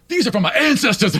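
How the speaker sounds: fast, intense half-yell. Retold or imitated. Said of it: "he's yelling about it".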